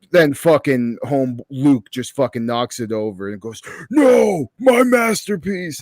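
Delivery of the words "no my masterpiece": high, drawn-out wail of protest; hammed-up despairing shout; mock anguished cry. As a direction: cry out like a melodramatic villain robbed of his prize. Quotes character's cry over ruined work.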